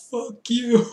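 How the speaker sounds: high, loud mock battle cry; comic retold shout; imitated yell. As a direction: yell it like a wrestler mid-flip, half laughing. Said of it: "he just yelled out".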